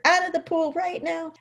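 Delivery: very high, insistent command; replayed parental fury without shouting; retold mad order. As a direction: finish the order in a high, strained pitch. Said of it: "I'm so mad".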